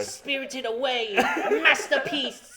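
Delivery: slow mock praise through laughter; laughing. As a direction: drawl it out laughing. Mocking the comic, laughing.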